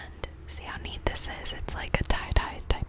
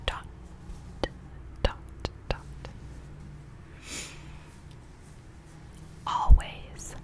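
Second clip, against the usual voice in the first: whispered pep talk. Acted. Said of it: "the next one is".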